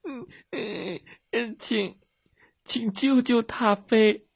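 put-on high, whimpering child's sobs; crying. A narrator's reading. Girl begging rescuers for help, crying. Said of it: "米莉哭着说".

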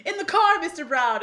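high, loud quoted order; lightly voiced Joey. Joey hustling Frankie to the car, lightly voiced order. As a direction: call it out as a command. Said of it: "Joey pulls Frankie and says".

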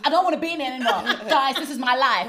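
loud hammed-up diva demanding the cameras stop; mock reality-star outburst. Mocking reality show stars who want filming to stop, loud hammed-up tantrum. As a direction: shout it like a reality star storming off set, half laughing. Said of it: "Please, Love and Hip Hop do".

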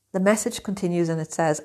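ordinary talk

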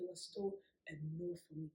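usual speaking voice

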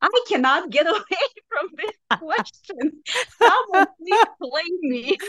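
high-pitched, half-laughing mock despair; laughing exasperation. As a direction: laugh it off as if fed up with the question. Retelling her own reply abroad.

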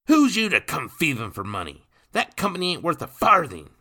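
put-on loud, high drunken protest; high-pitched indignant yelling; angry shout. A narrator's reading. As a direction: shout down the money collectors. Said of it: "Nathan shouted".

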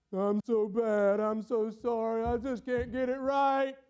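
slow, sing-song whine; mock self-pity. Preacher playing a victim of his sin, mock self-pity. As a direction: drag it out in exaggerated misery.

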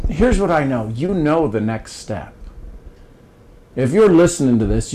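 ordinary talk, nothing marked

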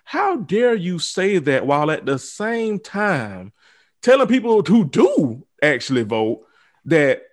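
normal talking voice

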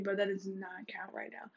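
hushed aside; whispering. Real, not acted.

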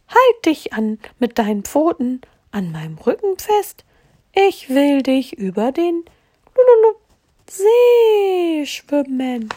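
high, bubbly fish voice with "blub" and "Lululu"; high gurgling fish comforting the hare. Acted. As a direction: talk like a kind fish blowing bubbles. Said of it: "hob ein Fisch den Kopf ... und sagte".

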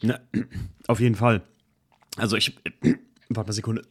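clearing throat after swallowing wrong; choking on a drink. Real, not acted. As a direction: talk through a frog in the throat. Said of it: "Jetzt hab ich ihn verschluckt".

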